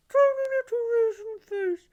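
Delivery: very high, squeezed and small; tiny pinched high voice; raisin face voice. Shown as a demonstration. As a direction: scrunch the face and squeak it out.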